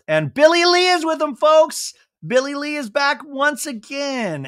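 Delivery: high, sing-out announcer call; mock-grand high proclamation; announcer-style hype. Mimics an excited announcer introducing her.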